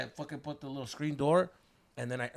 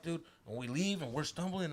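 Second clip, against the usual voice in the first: usual speaking voice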